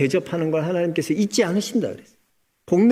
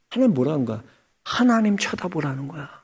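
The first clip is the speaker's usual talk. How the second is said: ordinary talk, nothing marked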